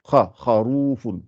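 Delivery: "Kha" then "kharufun" slowly with a rasped kh; slow kha demo. Shown as a demonstration. Teacher repeats for a student who asked, slow and clear. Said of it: "Ça fait kha".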